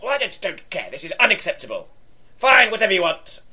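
high-pitched huff building to a loud snap; protest, then give in crossly and loudly; indignant outburst. Acted. Stern woman overruled by her colleague, indignant.

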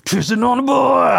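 high loud hammed-up bellow; mock shout. Lifting weights with headphones in, a high loud mock shout. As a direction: sing along badly at the top of the lungs.

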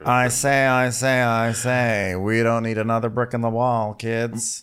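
cartoon rooster's repetitive bluster; Foghorn Leghorn. Cartoon rooster lecturing the kids, mock blustery impression. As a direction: open with stuttered-out bluster, then settle. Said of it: "looks like Foghorn Leghorn".

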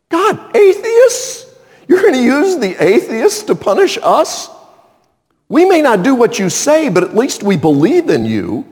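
high-pitched, appalled disbelief; incredulous protest. Acted. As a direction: protest to God as if scandalized. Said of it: "Isn't that what you'd say?".

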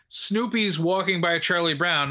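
usual speaking voice

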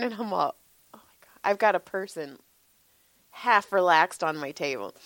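ordinary delivery

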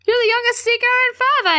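very high, rushed astonishment; mock amazement. Ron reacts to Harry's news, mock amazed. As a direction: blurt it out in hammed-up awe.

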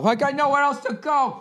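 lightly voiced student. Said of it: "maybe you got nowhere to go".

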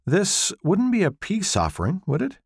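a sly little smile in the voice; sly teasing. Acted. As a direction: tease him, you've caught him out. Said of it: "A sly smile".

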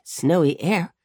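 plain reading voice

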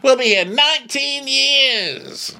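high, slow put-upon whine; mock weary locals. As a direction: moan it like exasperated locals. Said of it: "They're like".

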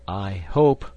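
frightened. A narrator's reading. Man fearing for his diamond, mildly frightened. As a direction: dread held in, voiced firmly. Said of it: "half dead with fright".

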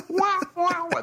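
high sung wah wah wah, like a sad trombone; mock sad trombone stinger. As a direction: finish the scene with a comic stinger. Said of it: "That's like the stinger".

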